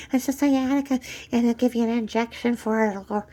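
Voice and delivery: high, sing-song mock of her know-it-all claim; mocking impression. Mocking a friend's claim about sciatica to the listener, high singsong.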